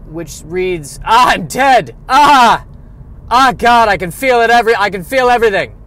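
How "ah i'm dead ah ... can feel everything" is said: loud, high agonized wailing of a corpse; mock dying scream. Podcaster voicing the corpse in Rembrandt's painting, agonized shout. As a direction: scream it like a dead man feeling everything.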